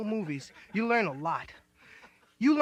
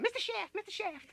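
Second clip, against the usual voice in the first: much higher, soft timid calling; scared sidekick; 11 semitones higher, 4 dB quieter than usual. Retold or imitated. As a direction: call out timidly for help.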